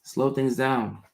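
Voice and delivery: slow, calm, "Slow" leaned on; slow repeat. Shown as a demonstration. Coaching a viewer to slow down, slow and even. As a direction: repeat it slowly and evenly. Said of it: "Use your limbic voice".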